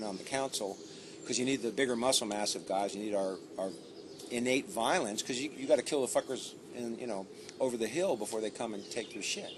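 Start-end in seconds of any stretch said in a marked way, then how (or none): none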